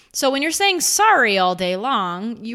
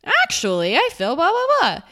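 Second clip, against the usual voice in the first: mock surprised; 8 semitones higher, 3 dB louder than usual. Shown as a demonstration. Mocking the actually habit.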